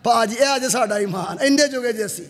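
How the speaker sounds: usual speaking voice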